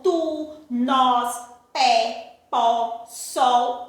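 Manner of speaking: "tu, nós, pé, pó, sol" each firm and separate, pitched low; strong, stressed monosyllables. Shown as a demonstration. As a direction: hit "tu, nós, pé, pó, sol" one by one with full stress. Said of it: "são pronunciadas com intensidade". ("tu, nós, pé, pó, sol" com intensidade, um a um)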